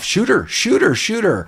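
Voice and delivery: high-pitched rapid-fire repeated order; urgent mock command. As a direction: imitate a panicked crook giving orders. Acted movie quote, urgent order.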